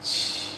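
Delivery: soft unvoiced "shh"; hushing noise. Streamer reacts to a character's grief talk, soft shushing noise. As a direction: let out a long, quiet hiss of a shush.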